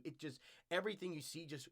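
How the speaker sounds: ordinary talk, nothing marked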